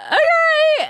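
high-pitched, drawn-out baffled okay; taken aback. Retold or imitated. Storyteller acting the guy answering an odd refusal, taken aback.

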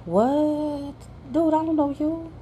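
high, disbelieving what; baffled, pushing him off high; incredulous. Retold or imitated. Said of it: "I was like".

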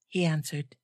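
plain reading voice

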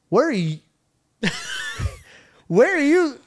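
high, giggling question; laughing disbelief. Retold or imitated. Driver asking the four girls in his car, high and laughing. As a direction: laugh through the question, unsure.

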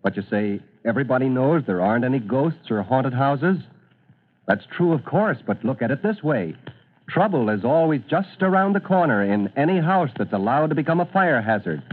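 ordinary delivery

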